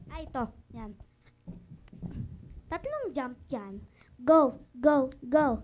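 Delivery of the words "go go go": loud go go go; energetic dance cue; calling out cues. Shown as a demonstration. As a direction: call the moves to the dancers. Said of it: "teaching the dance moves".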